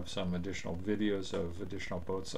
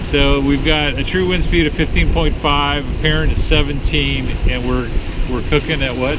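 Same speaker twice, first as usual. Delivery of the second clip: shouting over wind; 6 semitones higher, 18 dB louder than usual. Real, not acted. Talking on deck in wind.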